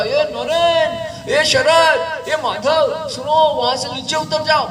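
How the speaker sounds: put-on high, loud child's call up the tree. Boy calls his friends down from the tree, loud and high. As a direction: shout to the friends to climb down.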